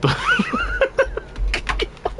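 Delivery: squealing helpless laughter; high-pitched laugh. Real, not acted. Streamer breaking character, laughing high. Cracks up mid impression.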